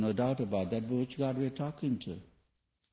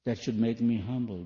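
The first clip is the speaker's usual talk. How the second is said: usual speaking voice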